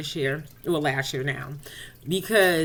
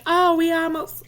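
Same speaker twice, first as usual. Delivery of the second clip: excited delight; 10 semitones higher, 5 dB louder than usual. Retold or imitated. Delight at rhyming names.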